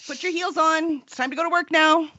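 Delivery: lightly voiced chipper denial; breezy mock order from a pretending community; pretending all is fine. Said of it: "this whole community pretending".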